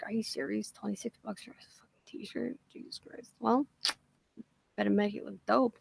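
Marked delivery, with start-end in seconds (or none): 0.0-3.3 a hushed whisper of disbelief. Retold or imitated. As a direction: mutter it under your breath, stunned. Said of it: "'cause I'm just like".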